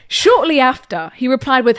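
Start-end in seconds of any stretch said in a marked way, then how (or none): none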